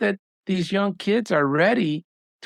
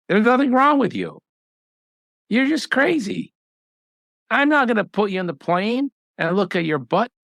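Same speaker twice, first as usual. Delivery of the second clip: higher, dismissive retelling of the doctor; mock blunt doctor; 5 semitones higher than usual. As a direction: toss it off like a gruff doctor brushing her off. Retold doctor scolding patient.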